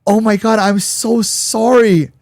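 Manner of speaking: high, loud, slow gush of sorry; sudden over-the-top remorse; gushing apology. Retold or imitated. Girlfriend suddenly gushing sorry at him, high and loud.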